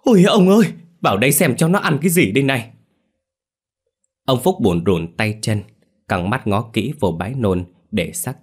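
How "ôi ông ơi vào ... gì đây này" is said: much higher, louder panicked shriek, then rushed; shrieking alarm. Acted. Grandmother shrieking for grandfather to see the vomit, panicked shout. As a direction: scream for her husband to come see. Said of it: "Bà Xuyến hét toáng lên".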